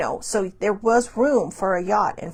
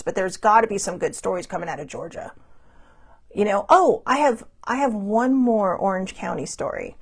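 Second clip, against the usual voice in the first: ordinary delivery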